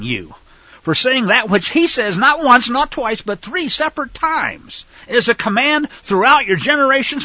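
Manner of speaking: loud, rising fiery rebuke of liars; angry preaching. Real, not acted. Preacher condemning churches that call the feasts done away, furious shout. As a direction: thunder it at the congregation, full force.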